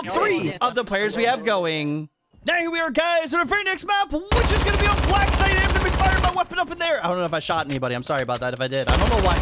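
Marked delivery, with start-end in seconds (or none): 2.5-7.0 high-pitched, energetic host announcement; hyped map intro. Real, not acted. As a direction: welcome everyone to the next round with big energy.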